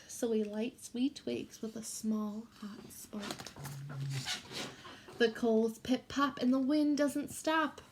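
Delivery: put-on reading a picture book to children; gentle read-aloud for a class; story reading. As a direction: read it like storytime for little kids.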